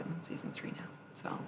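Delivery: whisper. Real, not acted. Aside about True Blood.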